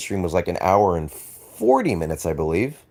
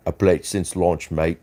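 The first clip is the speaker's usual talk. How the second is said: put-on light British accent on a chat read; mock British accent; 2 semitones lower than usual. Streamer reading a viewer's chat in British accent. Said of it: "you got the accent wrong".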